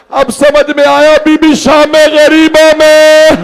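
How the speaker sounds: high, slow anguished shout; keening mourning shout; wailing climax. Acted. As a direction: cry it out to the mourners. Lament climax.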